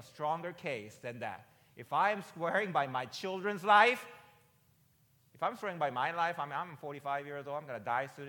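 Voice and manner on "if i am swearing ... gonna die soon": loud, high, impassioned preaching; preaching at full force. Real, not acted. Preacher swearing by his life to the congregation, impassioned. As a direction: build it to a preacher's cry.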